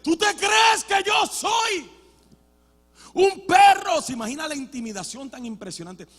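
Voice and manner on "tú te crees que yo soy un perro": slow, high, loud giant bellowing; Goliath's roar. Acted. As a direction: roar the challenge down at the boy. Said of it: "Goliat diciéndole".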